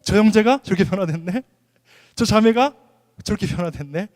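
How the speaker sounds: mock double-take of surprise; pleasantly astonished. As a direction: act amazed at someone's change. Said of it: "여러분 보면서 놀랐으면 좋겠습니다".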